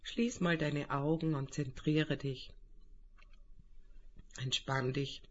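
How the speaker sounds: calm guided meditation voice; right in your ear. Acted. Leitet eine Meditation an. (ruhige Meditationsstimme)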